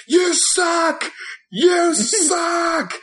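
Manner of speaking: very high, loud screamed jeer; imitated kid scream. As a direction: scream it like a kid heckling at wrestling. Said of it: "he's yelling and screaming".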